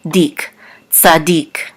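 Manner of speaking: "dik" alone, then "tzadik" stressed on DIK; last-syllable stress. Shown as a demonstration. Teacher shows the accent, "tzadik" stressed on its last syllable. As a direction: land on the end of "tzadik", tza-DIK. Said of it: "the accent is on the last syllable".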